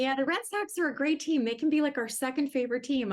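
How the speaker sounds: a hint of a cheerful, confident father; lightly voiced dad, playful; imitating dad. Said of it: "My dad used to say to me".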